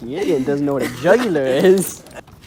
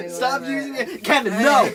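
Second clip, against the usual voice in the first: laughing and pleading, higher; laughing protest; 9 semitones higher than usual. Real, not acted. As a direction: giggle through the protest.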